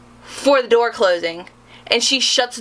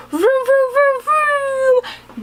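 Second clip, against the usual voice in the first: high mock engine noise, vroom vroom; 14 semitones higher, much slower than usual. Plane circling the tarmac, mimicked as a high engine vroom. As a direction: imitate a plane revving on the tarmac.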